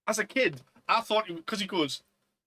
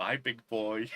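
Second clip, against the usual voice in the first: flirty goodbye; 5 semitones lower, slower than usual. Retold or imitated. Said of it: "and, like".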